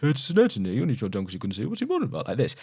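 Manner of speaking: fast, high imitation of garbled mumbling; incoherent mumbling. Other guy translating the mumbler, just as incoherent. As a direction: rattle it off as a mock unintelligible translation. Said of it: "to translate it for him and goes".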